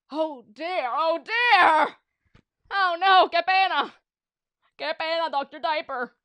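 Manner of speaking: loud, high panicked cry; panicked wail. Acted. Villain panicking.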